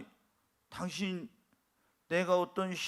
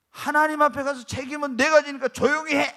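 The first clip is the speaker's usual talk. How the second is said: firm rebuke; 7 semitones higher, 8 dB louder than usual. Retold or imitated. He retells rebuking wife.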